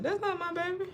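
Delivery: lightly voiced mother refusing the baby; mom's disbelief. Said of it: "and my mom said".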